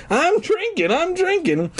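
high gleeful sing-song; mock-chant it grinning, up high; excited grin. Reader parodying the line, excited high sing-song. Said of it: "Dune answered with an excited grin".

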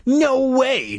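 high, emphatic refusal; insistent. A narrator's reading. Jay refusing to fetch help, insistent. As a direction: refuse it outright, pitched well up. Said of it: "Jay insisted".

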